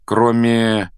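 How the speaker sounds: plain reading voice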